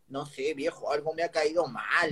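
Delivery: meek mumbled reply to an angry father; sheepish son. Retold or imitated. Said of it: "le digo".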